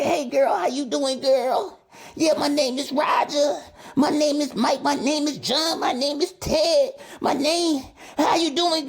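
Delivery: high, oily mock pickup voice; smooth-talking snake. Host imitating a smooth-talking player hitting on a girl, high mock voice. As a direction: sweet-talk the girl like a sleazy player. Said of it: "They just slide on in".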